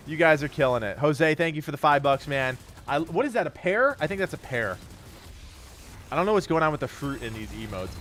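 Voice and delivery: ordinary delivery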